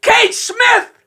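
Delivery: high, loud, slow yelled heckle; heckler shout. Retold or imitated. Ringside heckler yelling at the announcer, loud and high. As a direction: bellow it from ringside. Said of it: "the guy ringside goes".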